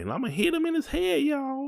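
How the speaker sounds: high, playful squeaky joke. Real, not acted. Husband jokes to listeners about wife, high teasing. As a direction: pitch up into a teasing tone. Joking threat after laughing.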